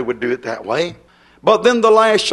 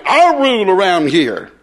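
mock domineering woman laying down the law; stubborn bossy woman; 2 semitones higher than usual. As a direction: imitate a stubborn wife with a masculine attitude. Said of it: "with an old masculine attitude".

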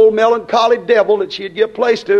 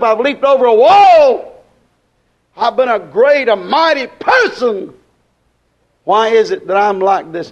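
rolling run of pulpit questions; swelling revival cadence, stretched key words; impassioned preaching; 3 semitones higher than usual. Acted. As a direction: preach David's lament with force.

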